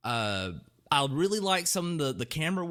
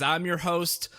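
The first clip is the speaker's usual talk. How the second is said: ordinary delivery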